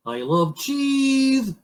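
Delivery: high, drawn-out moose declaration; cheese-loving moose. Acted. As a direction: stretch it out high with doting relish. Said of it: "said the moose".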